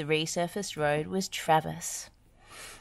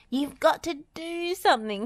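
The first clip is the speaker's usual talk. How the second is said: shrieking; 11 semitones higher than usual. Acted. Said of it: "shrieked Dizzy".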